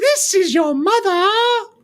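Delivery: lightly voiced nagging mum, high and slow; a hint of a scolding mother; mock mother. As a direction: tease him as his own mother would. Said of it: "you sound like my mother".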